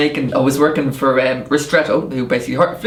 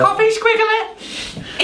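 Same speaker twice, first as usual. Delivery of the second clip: high, slow laughing squeal; much slower than usual. Shown as a demonstration. Joking about a business card title, laughing high and slow. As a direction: stretch it out through laughter. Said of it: "your business card's now gonna say".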